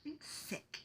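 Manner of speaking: tiny high whispered mock complaint; imitating sick self. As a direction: whisper it weakly, mimicking your own sick videos. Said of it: "multiple videos of me going".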